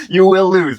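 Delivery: laughing through the repeated line. Retold or imitated. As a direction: crack up as you repeat it. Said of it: "He's like".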